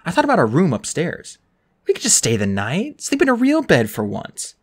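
ordinary delivery

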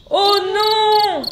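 high, loud alarmed cry; frightened cry. Acted. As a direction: gasp it out in fright. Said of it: "se disent les papillons".

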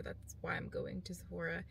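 hushed whisper to camera. Real, not acted. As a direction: whisper it so nobody hears. Quiet aside while filming in public.

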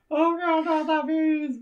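high-pitched hammed-up horror; mock panic. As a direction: wail it in pretend terror. Reprises the screamed movie line.

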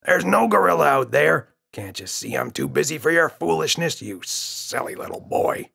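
irritated father. Acted. Father scolding his son on the beach, irritated. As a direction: scold the boy for his foolishness. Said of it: "glared at James and said".

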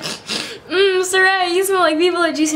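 imaginary fan. Pretend. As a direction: play a fan gushing over the perfume. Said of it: "then you can be like".

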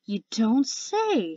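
slow, drawn-out sarcasm with a big swoop; sarcastic. A narrator's reading. Nautilus answers Webbs's news with slow sarcasm. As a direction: stretch it out in mock amazement.

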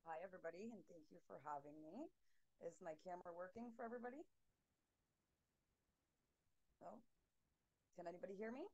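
very faint voice, far from the mic; faint and distant, mic too far away; barely audible. Real, not acted. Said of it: "Your voice is very faint".

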